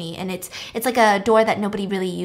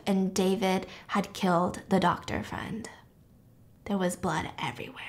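hushed storytelling whisper; suspenseful narrator whisper; 3 semitones lower, 9 dB quieter than usual. A narrator's reading. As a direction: drop to a whisper for the shock. Gruesome twist whispered.

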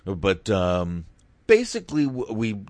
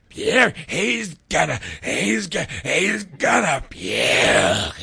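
loud, high, throat-shredding announcer-style impression; Vince; 7 semitones higher, 4 dB louder, slower than usual. Hamming up Vince for the co-host, strained throaty shouting. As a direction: bellow it in stuttering bursts, stretching the last word. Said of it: "Why don't you just say it like Vince".

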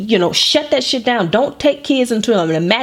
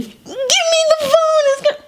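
put-on squeaky little kid demanding the phone; whiny kid voice. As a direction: whine like a toddler wanting the phone. Kid voiced mid tantrum.

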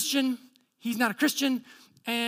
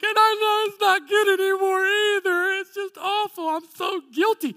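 high, loud mock wail; hammed-up crying woman; imitated crying; 9 semitones higher, 7 dB louder than usual. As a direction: wail it out as a tearful confession. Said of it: "all of a sudden she starts crying".

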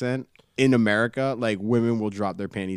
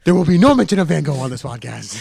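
higher, louder proclamation; mock stern decree; 4 semitones higher, 6 dB louder than usual. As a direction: lay down a mock ban loudly. Mock ban announced after the joke.

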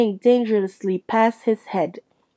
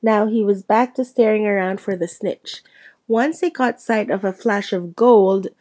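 usual speaking voice

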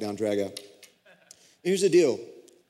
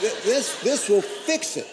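smug mock certainty; 7 semitones higher, 4 dB louder than usual. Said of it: "his old certainty, mocked".

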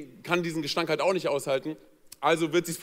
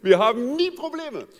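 smug couple; 7 semitones higher than usual. Retold or imitated. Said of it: "manche Pärchen sind ja so".

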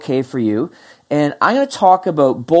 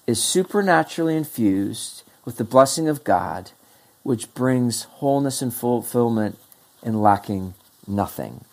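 hushed solemn; 6 semitones lower, 6 dB quieter than usual. Acted. Solemn climax.

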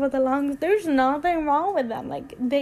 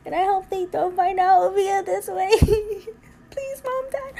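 higher, half laughing, half crying; laughing through tears; 7 semitones higher than usual. Real, not acted. Host coming out on air, laughing through tears. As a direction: laugh it off while tearing up. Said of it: "Why am I getting emotional again?".

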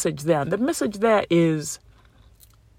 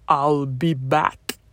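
put-on slow Arnold-style Austrian accent; 2 semitones lower, much slower than usual. Quoting Arnold's famous line in a slow Austrian accent. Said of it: "he said them in an Austrian accent".

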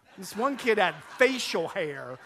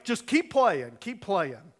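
mock-testy command; short-tempered umpire; 6 dB louder, slower than usual. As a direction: imitate an impatient umpire waving play on. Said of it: "Bob had a short trigger".

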